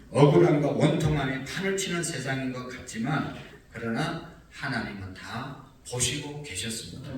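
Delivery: normal talking voice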